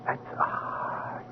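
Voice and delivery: hushed, stunned whisper; awed whisper. Acted. Man seeing his own corpse, stunned whisper. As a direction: breathe it out in shock. Sees own body under sheet.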